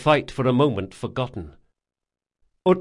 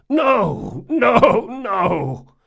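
high, broken repeated no with a cry; sobbing; 11 semitones higher, 5 dB louder, much slower than usual. A narrator's reading. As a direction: weep and refuse over and over. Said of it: "sobbed Jean".

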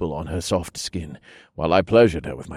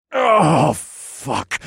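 growl; 8 semitones higher, 6 dB louder than usual. A narrator's reading. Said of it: "I growled".

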